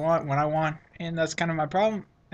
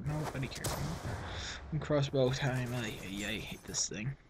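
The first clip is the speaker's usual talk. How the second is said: low, much softer grumble under his breath; muttering; 4 semitones lower, 8 dB quieter than usual. Real, not acted. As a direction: mumble it to yourself. Mumbling to himself.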